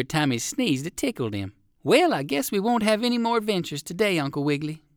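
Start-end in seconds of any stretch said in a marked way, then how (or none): 1.8-4.8 higher dog voice; a small dog musing; the doggy speaking. Acted. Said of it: "spoke the doggy".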